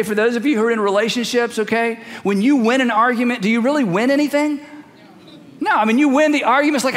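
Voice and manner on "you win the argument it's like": ordinary delivery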